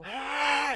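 high loud dying scream. Acted. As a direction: shriek as the man dies. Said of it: "and he's screaming".

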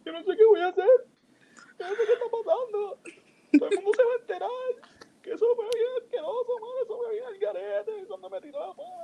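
very high hammed-up blubbering; mock crying. Crying to his buddy on the sidewalk, mock blubbering. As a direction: whine it all out like a comic sobbing wreck. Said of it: "por qué él está en la calle llorando".

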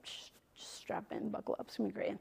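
hushed whisper, playful reassurance. Retold or imitated. God to us, whispering reassurance. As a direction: breathe it like a secret promise.

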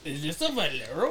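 low, soft attempt at a Swedish accent; unsure mock Swedish accent; badly faked Swedish accent. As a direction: have a half-hearted go at Swedish-accented English. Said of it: "Or however Sweden people talk".

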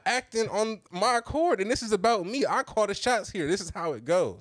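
high, emphatic laying down of the law; retold bossy lecture. Laying down rules in retold quarrel.

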